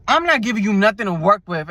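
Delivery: ordinary talk, nothing marked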